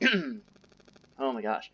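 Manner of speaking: hoarse, strained slow croak; losing voice. Real, not acted. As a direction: voice cracking, dragged out slowly. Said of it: "sorry, I'm losing my voice".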